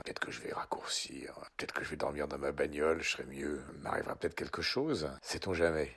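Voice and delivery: soft, low, half-whispered brooding; mock gloomy mutter. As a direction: mutter it darkly to yourself. Muttering gloomy thoughts on voicemail. (murmure sombre à voix basse)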